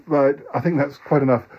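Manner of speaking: ordinary talk, nothing marked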